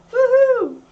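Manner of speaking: high, loud woohoo; excited cheer. Real, not acted. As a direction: cheer for the resub. Thanking chat for resub.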